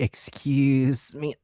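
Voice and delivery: slow, soft, drawn-out "excuse me"; squeezing past politely. Shown as a demonstration. Learner copying the cute tone used to squeeze past. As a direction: stretch it softly while slipping past. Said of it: "trying to go through".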